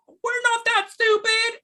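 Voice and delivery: very high, slow dopey crowd voice; mock dumb crowd. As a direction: play the insulted crowd as dim and whiny. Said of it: "And they're like".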